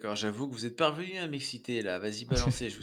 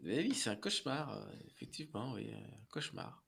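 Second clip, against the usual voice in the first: fading murmur; 3 semitones lower, 11 dB quieter than usual. Acted. Dazed, fading reply.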